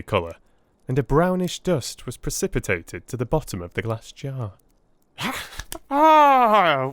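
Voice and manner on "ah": high, loud cry of joy; delighted cry. A narrator's reading. Holmes watching the test succeed, delighted. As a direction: cry out like a child with a new toy. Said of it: "he cried, clapping his hands".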